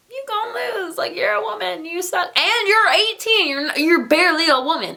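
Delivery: high, sneering taunt from rivals; mocking male rivals. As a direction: jeer at her in a high mocking voice. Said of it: "looking at her like".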